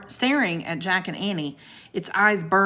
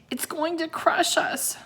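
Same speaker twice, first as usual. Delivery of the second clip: higher, alarmed inner voice; horrified thought; 10 semitones higher than usual. A narrator's reading. Jack fears the coiling serpent, alarmed and high. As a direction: panic rising in his head. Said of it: "Jack thought with horror".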